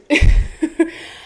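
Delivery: a breathy, high burst of embarrassed laughter; embarrassed giggle. Real, not acted. Vlogger giggling to viewers about her first period. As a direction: let out a quick giggle at the awkward word.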